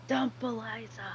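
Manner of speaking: soft eerie whisper; haunt him from inside his head. Pretend. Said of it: "make my voice sound really eerie".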